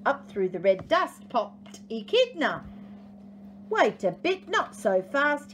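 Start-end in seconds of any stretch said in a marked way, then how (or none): 3.7-5.5 high-pitched prickly objection; put-on huffiness, pitched up; bristling. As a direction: cut in like an indignant echidna. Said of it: "he bristled".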